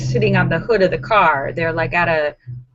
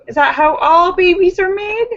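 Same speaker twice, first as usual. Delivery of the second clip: put-on high, loud, questioning kid; child's voice; 10 semitones higher, 5 dB louder than usual. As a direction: climb upward like an inquisitive child. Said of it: "Awkward conversations with your children".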